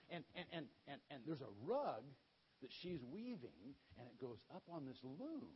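normal talking voice

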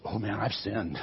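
a caught man owning up; mild remorse; a touch of remorse. Acted. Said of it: "Balaam goes".

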